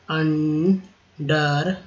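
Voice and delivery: Under drawn out very slowly, un-der; very slow drawn word. Shown as a demonstration. Teacher models the ई आर sound in under. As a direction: hold under very long. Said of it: "देखिए ई आर है".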